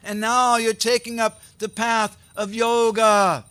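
high, slow declaimed reproach; loud rebuke. A narrator's reading. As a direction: chastise him loudly, stretching the words. Character rebukes Murari for taking yoga.

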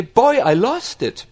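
casual New York slang. Retold or imitated. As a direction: toss it off like a New Yorker. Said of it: "in New York language you would say".

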